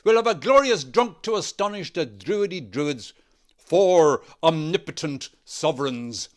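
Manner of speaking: loud, high, gleeful boast; delighted. A narrator's reading. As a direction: revel in the coming spree. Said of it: "cried with delight".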